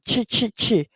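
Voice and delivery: Ch, ch, ch, short crisp bursts; teacher isolates the ch in chen, crisp ch bursts; ch sound drill. Shown as a demonstration. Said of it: "Chen P".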